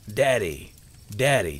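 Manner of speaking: put-on loud, calling out like a young boy; boy's yell. A narrator's reading. Son running toward his father, yelling. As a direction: call out loudly for Daddy. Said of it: "Sam yelled as he was nearing his father".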